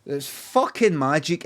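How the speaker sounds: usual speaking voice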